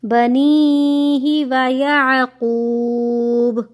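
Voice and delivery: slow, melodic tajweed chant; teacher reciting verse slowly, melodic chant; Quran recitation. Shown as a demonstration. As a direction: draw out each vowel in recitation.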